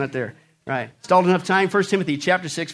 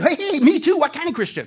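mock-thrilled, pitched way up; hammed-up delight; 8 semitones higher than usual. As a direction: exclaim it with comic over-eagerness. Joke character finds common ground.

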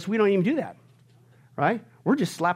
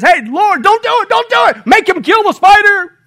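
much higher, louder excited shout; neighbor's outburst; 13 semitones higher, 12 dB louder than usual. Retold or imitated. Fed-up neighbor interrupting the deacon's prayer with a shout. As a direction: leap up and cry out to God. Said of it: "the neighbor jumps up and he says".

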